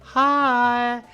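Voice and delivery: very high, bright put-on greeting; mock innocent hello while still in pain; fake cheery hi. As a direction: cover the agony with a sweet little hello. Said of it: "I went".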